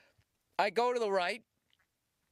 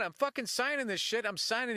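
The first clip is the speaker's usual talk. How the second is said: usual speaking voice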